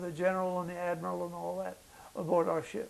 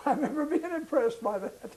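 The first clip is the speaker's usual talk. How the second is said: chuckling, voice lifted high with a laugh; 7 semitones higher than usual. Real, not acted. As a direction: laugh through the memory of being impressed. Amused memory of change machine.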